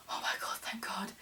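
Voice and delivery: very soft, voiceless thanks; whispered relief. Retold or imitated. Back at the car after the scare, whispered relief.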